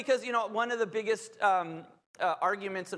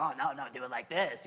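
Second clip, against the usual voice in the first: low, softer devil voice through a laugh; little cartoon devil; 8 semitones lower, 4 dB quieter than usual. Cartoon devil on the shoulder tempting, low and chuckling. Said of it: "and then there's the devil".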